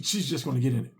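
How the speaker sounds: usual speaking voice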